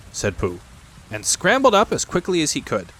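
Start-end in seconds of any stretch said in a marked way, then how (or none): none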